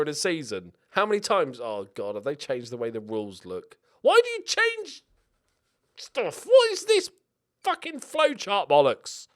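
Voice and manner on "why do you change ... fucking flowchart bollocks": high, indignant outburst; exasperated rant. Real, not acted. To the game makers about the flowchart rules, high and indignant.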